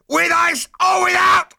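very high, loud, slow shouted question; officer bellowing at prisoners. Acted. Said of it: "bellowed number two".

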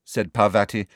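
plain reading voice

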